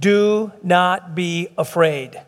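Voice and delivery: very slow, word by word; slow, deliberate emphasis. Shown as a demonstration. Preacher driving home Scripture's command to God's people, slow and deliberate. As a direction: draw out DO, NOT, BE, AFRAID separately.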